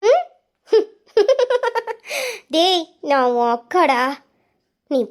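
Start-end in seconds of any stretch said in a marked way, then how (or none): 1.1-2.5 high-pitched chuckling laugh; mocking laugh. Sister hearing her brother claim nothing is wrong, mocking laugh.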